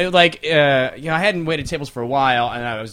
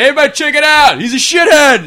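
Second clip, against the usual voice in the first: high, loud party holler; mock drunken shouting; drunk guy; 10 semitones higher, 7 dB louder than usual. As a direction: call out to the whole room like a drunk. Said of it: "this drunk guy goes".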